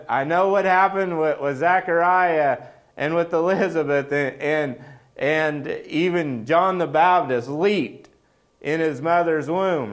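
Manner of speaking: high, loud, rushing excitement; excited heaven. Retold or imitated. Heaven telling itself the news, high-pitched and loud. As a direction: heaven can barely contain itself. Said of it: "They're like".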